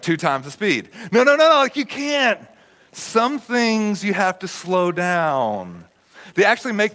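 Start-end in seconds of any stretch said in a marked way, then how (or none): 1.1-2.4 high, loud burst of objection; exasperated protest. Acted. Mock protest at hurrying. 2.9-5.8 stretched-out, emphatic words; half-speed delivery with long vowels; deliberately slow. Shown as a demonstration. Models slowing down after speed talk.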